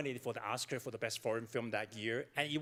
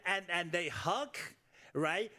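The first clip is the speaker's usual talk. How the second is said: ordinary talk, nothing marked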